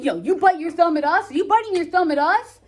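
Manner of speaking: street challenge. Acted. Modern paraphrase of challenge.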